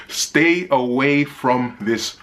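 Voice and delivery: slow, drawn-out warning; pointed warning. Real, not acted. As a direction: stretch each word out as advice to keep off. Pointed warning to some viewers.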